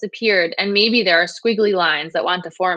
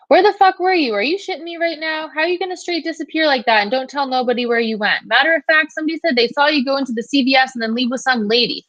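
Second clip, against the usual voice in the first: high-pitched, furious telling-off; angry yelling; 6 semitones higher than usual. A narrator's reading. Cousin chewing out Fabiola over the phone for disappearing, angry yelling. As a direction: lay into her with outraged questions, voice pitched up. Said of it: "she immediately starts to yell".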